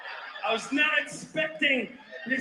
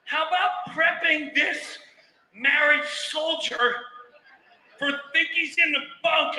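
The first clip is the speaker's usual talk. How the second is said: normal talking voice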